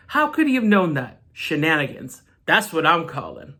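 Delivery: ordinary talk, nothing marked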